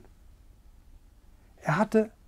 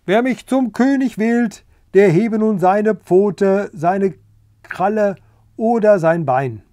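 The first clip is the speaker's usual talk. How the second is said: loud, higher royal announcement; loud proclamation; 5 semitones higher, 6 dB louder than usual. Acted. Rooster calling the vote to the assembled animals, loud. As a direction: proclaim it to the whole yard.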